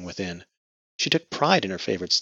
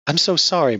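tearful apology; 8 semitones higher, 8 dB louder than usual. Acted. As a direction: voice breaking, higher and louder. Grieving widow apologizes to husband.

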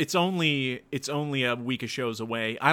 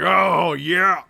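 loud, higher mock cheer of delight; Mark Henry impression; 4 semitones higher, 8 dB louder than usual. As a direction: belt it out like a big wrestler. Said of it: "Mark Henry's just like".

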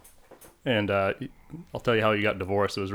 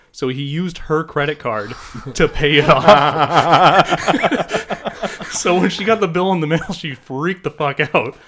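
laughing while telling; 6 semitones higher, 9 dB louder than usual. Real, not acted. Telling the punchline of a funny story.

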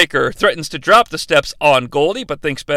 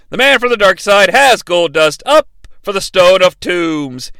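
ordinary talk

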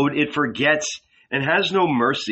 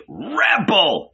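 howled; 7 semitones higher than usual. A narrator's reading. The guardian angel howling in rage at Jesus. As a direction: hurl the word like a howl. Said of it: "he howled".

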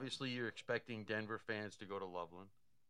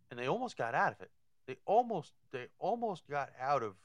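excited emphasis; 5 semitones higher, 8 dB louder than usual. Real, not acted. Excited about escaped traps.

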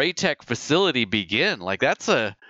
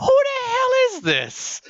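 much higher, slow, squeaky mock alarm; startled homeowner; slower than usual. Imitating the confused guy inside answering the loudspeaker. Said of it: "And the guy inside going".

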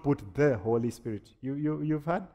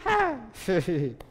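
laugh; 8 semitones higher than usual. Real, not acted. Laughing at own joke.

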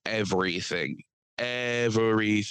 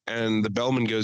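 ordinary talk, nothing marked

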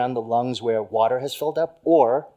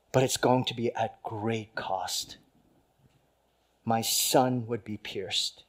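solemn voice of God; 3 semitones lower, 6 dB quieter than usual. Acted.

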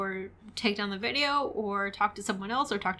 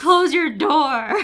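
loud, drawn-out, higher-pitched echo of an impression; mock command; 5 semitones higher, 9 dB louder, slower than usual. Host echoing the Yolanda impression, loud mock command. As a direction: stretch it out as a big playful order.

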